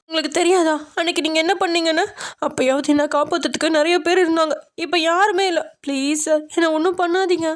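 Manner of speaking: high-pitched frightened begging; tearful pleading. A narrator's reading. Frightened woman begs sir not to harm her, high and pleading. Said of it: "திரும்ப திரும்ப அழுகுறா".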